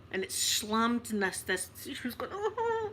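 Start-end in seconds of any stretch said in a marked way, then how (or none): 2.3-2.9 imitated wailing. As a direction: mimic a wee lady's pained wail. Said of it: "like that, this wee lady".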